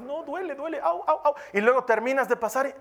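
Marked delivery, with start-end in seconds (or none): 0.0-1.3 high whimpering ow ow; mock child in pain. Child on stony path complaining it hurts. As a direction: whine like a barefoot child.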